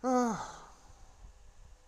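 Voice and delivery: high sigh falling away. Real, not acted. Bored commentator watching the film, long falling sigh.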